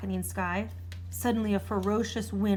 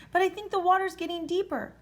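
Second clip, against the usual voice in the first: shrieking; 10 semitones higher, 4 dB louder than usual. A narrator's reading. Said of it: "shrieked the dragon".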